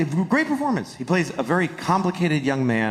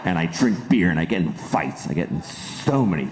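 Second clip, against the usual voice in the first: low, slow, self-admiring mock tough guy; hammed-up low macho brag; Matt Damon; 11 semitones lower than usual. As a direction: play a working-class hero boasting in a low voice. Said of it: "he basically sat down, he's like".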